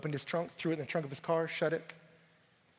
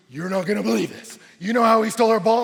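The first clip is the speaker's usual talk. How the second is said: put-on loud, high, excited kid telling news; freaking-out kid; 7 semitones higher, 15 dB louder, faster than usual. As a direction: blurt it out, pitched up and loud. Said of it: "all the kids were in my front yard freaking out".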